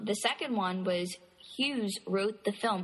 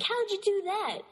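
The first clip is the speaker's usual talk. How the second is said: high-pitched incredulous question; mock amazement; 13 semitones higher than usual. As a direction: imitate someone baffled, pitch jumping way up. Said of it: "you're gonna be like".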